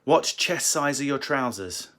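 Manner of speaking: ordinary talk, nothing marked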